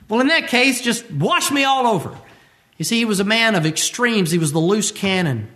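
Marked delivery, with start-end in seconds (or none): 0.1-2.2 eager Peter. Retold or imitated. Peter retold again, higher.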